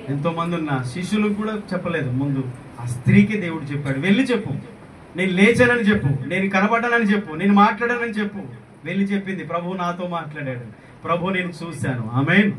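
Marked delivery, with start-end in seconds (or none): 4.0-8.4 high, forceful repeated orders; urgent command. Acted. Preacher as the risen Lord sending Mary, urgent command. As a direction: send her off with ringing insistence.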